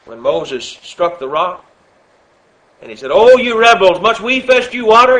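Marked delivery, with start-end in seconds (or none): 3.1-5.2 angry rebuke. Acted. Moses' angry rebuke voiced.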